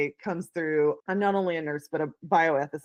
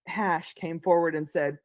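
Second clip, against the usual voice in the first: normal talking voice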